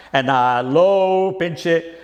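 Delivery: plain reading voice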